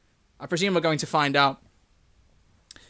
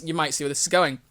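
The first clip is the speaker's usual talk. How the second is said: plain reading voice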